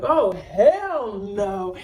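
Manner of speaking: drawn-out, slow mock outrage; Tori's indignant refusal. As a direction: stretch out each word as an imitated objection. Acts out friend jumping in.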